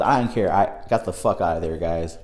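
ordinary talk, nothing marked

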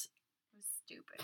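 whispering it under breath; whispered. Real, not acted. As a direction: whisper the verdict. Whispered repeat of insult.

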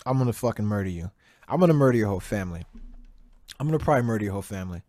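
fast tumbling threats, words running together; rushed threats. Real, not acted. Rapid threatening rant.

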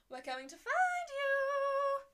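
high, slow, loud taunting chant; sing-song taunt. Acted. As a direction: taunt like a playful stalker. Romans calling out.

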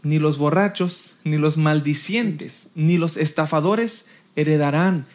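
measured, low scripture delivery; scripture reading. Acted. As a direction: read the list deliberately, pausing between items. Resumes reading the scripture list.

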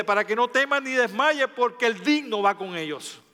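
building from steady to fervent prayer; intercessory prayer. Acted. (oración de intercesión cada vez más ferviente)

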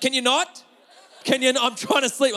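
mock-exasperated complaint from bed; annoyed wife. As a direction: scold him like a woken light sleeper. Wife snaps at him for noise.

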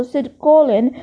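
usual speaking voice

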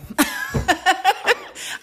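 high burst of laughter. Real, not acted. Mom laughing at herself over cold hash browns, high laugh. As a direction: laugh at yourself, high and open. Laughing at her own food habits.

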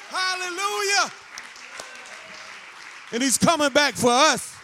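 high, loud building sermon climax; preaching at full force. Real, not acted. Preacher proclaiming to his congregation, building to a shout.

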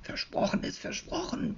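high, soft whisper from under the flap; murmured from inside. A narrator's reading. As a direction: murmur it, muffled inside the satchel. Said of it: "murmelt es unter der Klappe".

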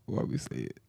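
low, very soft sigh of regret; hushed, deflated pretend remorse; mock regret. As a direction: sigh it out under your breath. Imagining regret on hearing playback.

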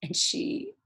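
real tears breaking through; choking up retelling the call; getting choked up. Real, not acted. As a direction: voice cracking, rising high. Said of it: "I'm getting, like, really".

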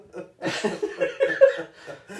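high hearty laugh; loud laugh. Real, not acted. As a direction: burst out laughing. Laughing after shouting Yo.

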